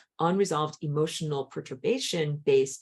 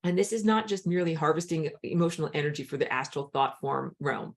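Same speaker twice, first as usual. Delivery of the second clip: ordinary delivery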